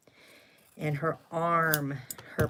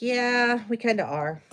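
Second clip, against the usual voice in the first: defiant yeah; 6 semitones higher, 6 dB louder than usual. Retold or imitated. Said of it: "And I was like".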